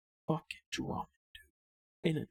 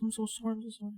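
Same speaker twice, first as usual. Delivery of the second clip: retold pleading apology; 6 semitones higher, faster than usual. Retold apology, told again.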